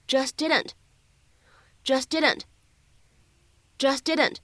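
"just didn't" with the t of just unreleased; lost plosion. Shown as a demonstration. 老师示范 "just didn't" 失去爆破. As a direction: swallow the t of "just" before "didn't". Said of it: "典型的失去爆破的处理".

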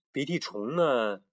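plain reading voice

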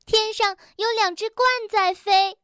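high, eager announcing; excited. A narrator's reading. Rabbit telling his friend about the storks, excited. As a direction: point up at the sky with glee. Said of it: "蹦蹦兴奋地喊道".

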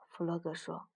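ordinary delivery

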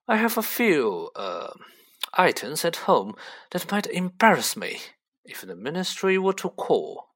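a hint of lordly self-importance; lofty Malfoy. Voices Mister Malfoy.

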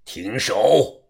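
low, soft drawn-out order; restrained deep authority; commanding elder. A narrator's reading. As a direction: halt them with a quiet low command. Leader halts the fight.

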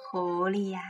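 usual speaking voice

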